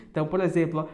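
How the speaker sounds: ordinary talk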